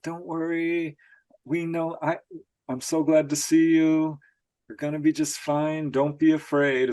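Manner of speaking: high, lilting reassuring voice; sing-song voice. Retold or imitated. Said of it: "talking to them in this sing-song voice".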